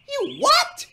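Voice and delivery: high, loud shriek of disbelief; very high, loud, indignant outburst. A narrator's reading. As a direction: shriek it in outrage. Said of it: "James shrieked".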